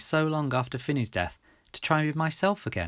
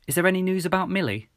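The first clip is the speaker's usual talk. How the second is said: higher-pitched hopeful question; tentative hope in a raised voice; 8 semitones higher, 3 dB louder, faster than usual. A narrator's reading. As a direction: ask it hopefully, voice lifted.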